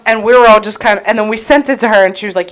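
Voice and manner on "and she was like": ordinary delivery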